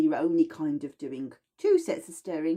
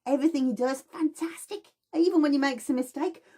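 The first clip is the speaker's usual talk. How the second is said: enthusiastic tutor; 8 semitones higher, faster than usual. Retold or imitated. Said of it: "she's like".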